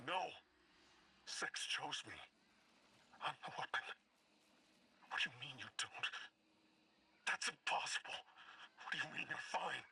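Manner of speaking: agitated, distorted outburst; distressed protest. Acted. Bale insists to the emissary that Six chose him, distressed protest. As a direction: protest in rising disbelief, voice distorted.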